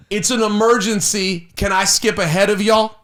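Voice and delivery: high-pitched, desperate appeal to a queue; urgent plea. Retold or imitated. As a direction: act out begging strangers to let you through. Retold plea to people in line.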